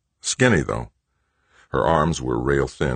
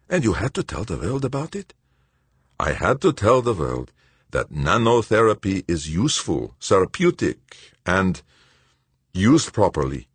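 put-on higher, quicker voice for the anxious old friend; Verban, worried old colleague; 6 semitones higher, faster than usual. A narrator's reading. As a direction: voice Verban as tense and urgent. Verban's voice in the read story.

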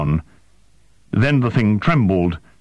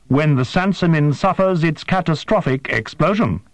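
higher, stiff and formal alien voice; alien interpreter; 9 semitones higher than usual. A narrator's reading. Interpreter questions the human, high and formal. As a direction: ask with precise, careful, unhuman politeness.

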